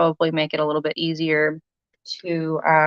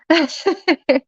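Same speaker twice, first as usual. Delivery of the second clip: quick high giggle, a bit embarrassed; bashful little laugh; nervous laugh; 10 semitones higher than usual. Real, not acted. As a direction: laugh off the nerves. Nervous about showing her face.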